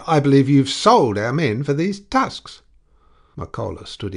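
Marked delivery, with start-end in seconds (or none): 0.1-2.6 higher and much louder accusation; furious, outraged shout; angry shout. A narrator's reading. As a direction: hurl the accusation. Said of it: "he shouted".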